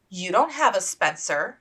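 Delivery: countering. Acted. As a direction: fire back pertly. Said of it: "she countered".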